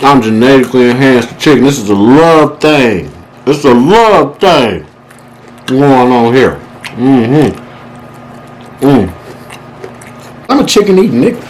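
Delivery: ordinary talk, nothing marked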